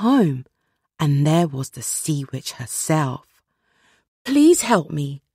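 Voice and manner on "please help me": begging. Acted. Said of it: "begged the Little Mermaid".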